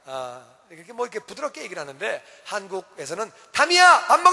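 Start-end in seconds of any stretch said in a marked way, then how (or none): 3.5-4.3 much higher, loud yell of the name; shouting parent. Retold or imitated. Korean parent yelling at child to come eat. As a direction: bellow up the stairs for dinner. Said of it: "이렇게 소리를 질러버리니까".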